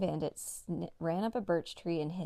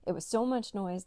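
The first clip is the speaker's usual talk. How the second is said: ordinary delivery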